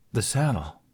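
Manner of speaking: usual speaking voice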